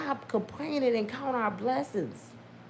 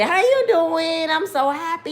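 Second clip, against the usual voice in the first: fake happiness; 6 semitones higher, 6 dB louder than usual. Faking joy to a supervisor who dislikes you. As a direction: lay the fake joy on thick. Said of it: "Look, fake it".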